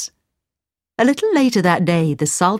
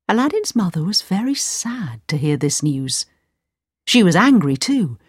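ordinary delivery

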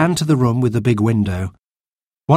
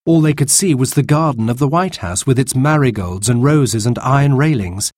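ordinary delivery

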